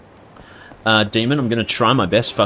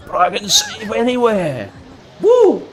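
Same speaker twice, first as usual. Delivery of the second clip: hammed-up triumph; 12 semitones higher, 4 dB louder, slower than usual. Showing off save feature.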